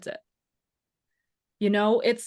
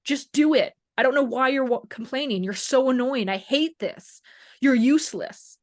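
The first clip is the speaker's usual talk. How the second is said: higher, louder mock scolding; frustrated yelling at aching shoulder; mock angry; 4 semitones higher, 4 dB louder than usual. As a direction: snap at your own body in frustration. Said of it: "and we go".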